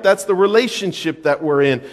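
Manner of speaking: ordinary delivery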